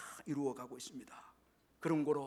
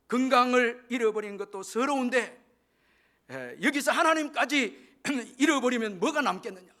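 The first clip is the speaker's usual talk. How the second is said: raised, louder acted mother's plea; earnest pleading; 6 semitones higher, 6 dB louder than usual. Mother urging her son not to lose God, acted earnest plea. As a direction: press the point urgently as a pleading mother.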